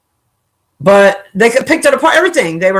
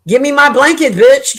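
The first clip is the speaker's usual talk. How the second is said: mock dude demanding; 6 semitones higher than usual. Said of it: "I can't imagine a dude, like".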